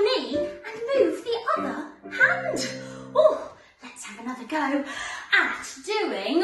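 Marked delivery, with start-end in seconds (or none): none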